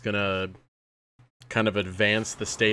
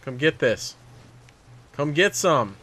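taunting shout; 11 semitones higher than usual. Real, not acted. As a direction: call them out to fight. Taunting enemies in a fight.

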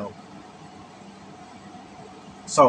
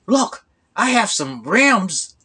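higher, slowed, salesman sweetness; lightly voiced smooth-talking scammer; hustler's pitch; 4 semitones higher, slower than usual. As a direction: sell him the rims smoothly.

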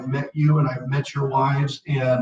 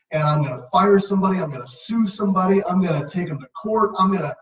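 mock hothead rant; 4 semitones higher, faster than usual. Rattling off angry plans.

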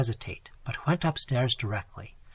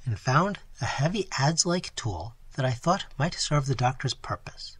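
ordinary delivery

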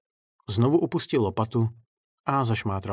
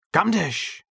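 much higher call after him; shouted question; 9 semitones higher, 3 dB louder than usual. Acted. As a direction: yell it at his back. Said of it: "zakřičel na něj klaun".

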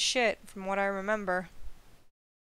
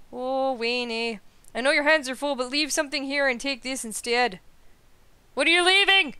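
a lightly voiced Canadian officer; Canadian accent; 5 semitones higher, 5 dB louder than usual. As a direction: read the officer's lines with a Canadian "eh" lilt. Voices Canadian NPC dialogue.

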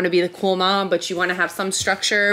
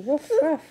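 playful dog woofs; 6 semitones higher than usual. Pretend. Mom plays farm book with baby.

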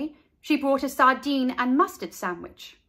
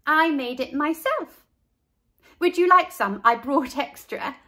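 higher, louder eager offer; eager girl; 5 semitones higher, 5 dB louder than usual. A narrator's reading. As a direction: proudly offer to share. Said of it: "said Carla".

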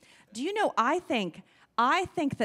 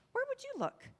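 high, gentle prompting question; high coaxing question; 13 semitones higher, 5 dB quieter than usual. Shown as a demonstration. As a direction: coax the kids with a high ask. Asking the children again.

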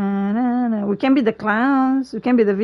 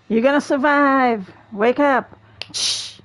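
normal talking voice

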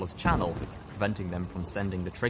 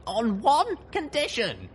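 high, slow, loud condition; laying down terms; 5 dB louder, slower than usual. A narrator's reading. Rescuer sets terms for the colonel, forceful. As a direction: call it out, stretched and forceful. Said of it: "I told Calamity's brother".

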